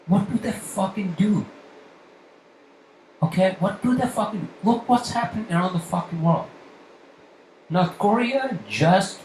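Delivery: venting real anger at the city traffic; furious rant. Real, not acted. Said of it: "this fucking rant".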